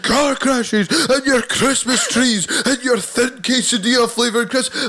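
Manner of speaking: high, slow back-in-my-day moaning; squeaky old codger complaining; grumpy old-timer. Acted. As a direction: lecture the youth like a cranky grandad. Grumpy old-timer rant about crisps.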